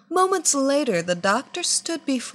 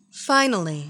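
plain reading voice

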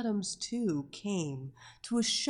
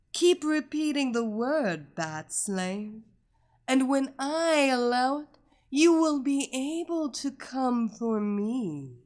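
louder, teasing command; taunting; 4 semitones higher, 5 dB louder than usual. A narrator's reading. Hypnotist taunting her captive, louder. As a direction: dangle the reward over her. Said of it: "Evelina taunted".

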